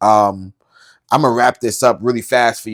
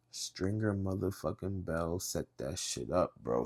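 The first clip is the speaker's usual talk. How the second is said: very soft, lower-pitched disbelief; hushed reveal; 4 semitones lower, 16 dB quieter than usual. Real, not acted. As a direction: drop right down to reveal the culprit. Dramatic reveal of the culprit.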